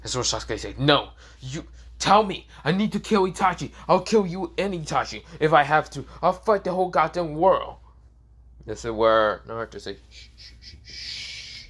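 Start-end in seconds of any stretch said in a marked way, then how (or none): 0.8-7.8 raised, higher-pitched angry outburst; acted screaming. Sasuke demanding answers from Naruto, screamed outburst. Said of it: "You don't need to scream out that loud". 10.1-11.6 soft voiceless shushes, last one drawn out; shushing. Acted. Naruto hushing little Sasuke, soft mocking shush.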